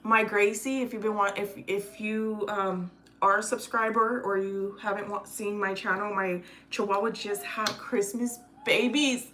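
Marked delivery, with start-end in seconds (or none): none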